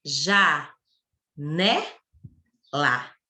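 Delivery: JÁ, NÉ, LÁ as three separate beats; long pause after each piece: JÁ, NÉ, LÁ; syllable by syllable. Shown as a demonstration. Teacher showing janela's três pedacinhos, JÁ, NÉ, LÁ said separately. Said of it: "três pedacinhos".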